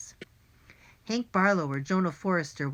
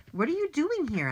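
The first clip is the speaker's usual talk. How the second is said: high-pitched puzzled question; surprised question; 10 semitones higher than usual. A narrator's reading. Boy finding his friend on the roof, surprised question. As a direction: ask it in surprise.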